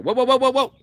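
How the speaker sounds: wide-eyed movie-star double take; high-pitched mock movie-star gasps of whoa; Leonardo DiCaprio. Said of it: "Like I'm Leonardo DiCaprio".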